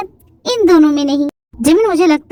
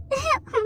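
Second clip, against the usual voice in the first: short giggle; 9 semitones higher, 10 dB quieter than usual. Acted. Wife laughs at story.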